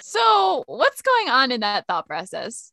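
normal talking voice

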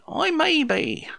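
drawn-out, high and evasive, playing the famous birdwatcher; Bill Oddie impression. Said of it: "That was my best Bill Oddie voice there".

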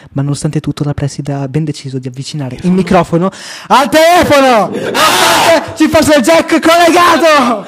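loud, high indignant outburst; outraged disbelief. Real, not acted. Indignant rant about the principal.

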